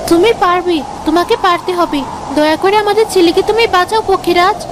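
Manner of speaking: high, urgent motherly plea; desperate pleading. Acted. Mother begging flying horse to save her son, pleading.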